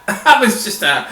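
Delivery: amused laughing echo; laughing repeat. Real, not acted.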